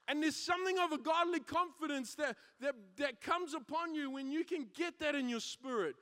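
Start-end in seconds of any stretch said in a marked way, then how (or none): none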